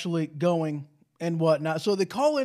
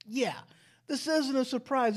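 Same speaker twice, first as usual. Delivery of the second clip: normal talking voice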